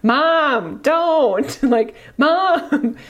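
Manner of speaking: high, loud pleading "Mom, don't"; embarrassed daughter. Retold or imitated. Retelling her daughter begging mom not to intervene, high embarrassed plea. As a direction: beg your mother to stop, mortified. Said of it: "She's like".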